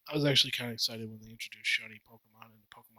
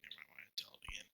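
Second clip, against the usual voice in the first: whispering; 8 semitones lower, 19 dB quieter, faster than usual. Real, not acted. Unvoiced aside.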